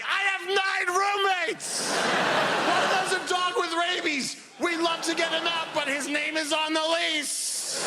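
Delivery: hammed-up stressed millennial; frantic young person. Young renter complaining about roommates, high laughing shout. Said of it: "every young person's like".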